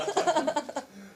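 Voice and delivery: real amused laughter. Real, not acted. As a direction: laugh at your own little story. Amused at her own story.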